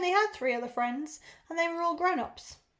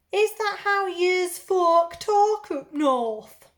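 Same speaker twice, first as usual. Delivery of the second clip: loud, high, slow put-on northern accent; drawn-out parody of northern English talk; mock northern accent; 5 semitones higher, 7 dB louder, much slower than usual. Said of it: "I apologise now for my accents".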